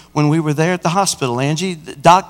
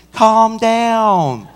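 higher, drawn-out appeal to a yelling driver; mock soothing retort; 6 semitones higher than usual. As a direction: placate an irate stranger, playing it up.

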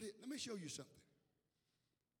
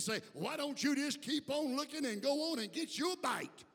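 loud, high-pitched sermon shout; preaching at full force; 6 semitones higher, 9 dB louder than usual. Real, not acted. Preacher warning church about temptation, full-force preaching. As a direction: drive each phrase home, raised and forceful.

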